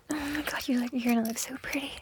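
usual speaking voice

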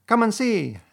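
high, loud, delighted call; elated rat calling out. A narrator's reading. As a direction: beckon a friend in delight. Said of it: "said the delighted rat".

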